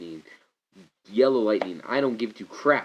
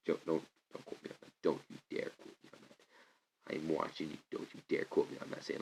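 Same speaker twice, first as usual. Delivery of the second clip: hushed, much softer whisper; 8 semitones lower, 15 dB quieter than usual. Real, not acted. Fan warning viewers not to quote him, whispered.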